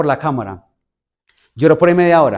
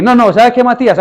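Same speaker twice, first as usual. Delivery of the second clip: stern father; 6 semitones higher, 5 dB louder than usual. Retold or imitated. Father scolds son over homework.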